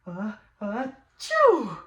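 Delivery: slow, loud drawn-out sneeze; hammed-up bear sneeze. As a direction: build up and blow the sneeze. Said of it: "and he goes".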